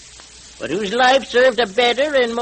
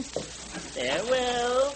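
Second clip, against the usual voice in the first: slow drawn-out farewell, much softer, fading; ghostly farewell; 10 dB quieter, slower than usual. Acted. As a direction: let it trail off like a ghost departing. Masked rider's ghostly parting words.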